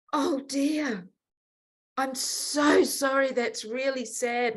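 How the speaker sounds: high-pitched mock sympathy; imitated pity. Voices visitor's pitying reply.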